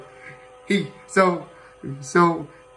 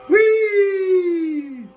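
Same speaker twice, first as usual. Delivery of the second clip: gleeful whee; 13 semitones higher, 3 dB louder than usual. Acted. Toy plane flips upside down.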